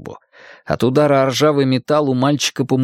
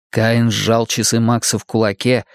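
usual speaking voice

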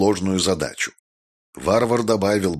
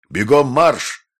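louder drill-style order; barked command; 5 semitones higher, 5 dB louder, slower than usual. A narrator's reading. Maestro barking an order at Jessica, loud drill command. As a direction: bark it like a sergeant.

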